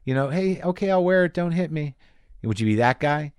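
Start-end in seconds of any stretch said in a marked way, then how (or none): 0.3-2.0 a raised, timid plea of "don't hit me"; cowering prisoner. Retold or imitated. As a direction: beg not to be hurt. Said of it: "Would you be the guy that's like".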